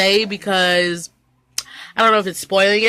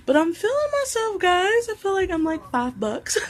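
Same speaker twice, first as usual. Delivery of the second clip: much higher, playful and proud; sassy, feeling herself; 11 semitones higher, 5 dB quieter than usual. Real, not acted. Showing off her church outfit to viewers, high and sassy.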